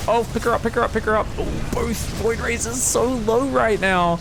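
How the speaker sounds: pleading with the player to save her; excited urging. Real, not acted. Caster urging a rescue, excitement.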